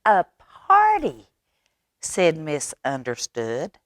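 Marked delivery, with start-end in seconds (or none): 0.0-1.3 high, louder burst of delight; light up at the idea. Acted.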